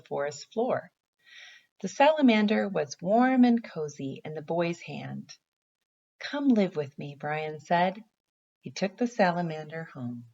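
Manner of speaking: gentle storybook read-aloud; reading to children. Acted. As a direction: read it like a picture book for little kids. Teacher reads picture book aloud.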